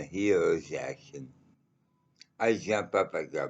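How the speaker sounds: ordinary talk, nothing marked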